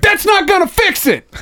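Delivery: high laughing shout after the kick; retold yell. Kicked in the head, yelling back at the guy through a laugh. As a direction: yell it, cracking up, pitch way up.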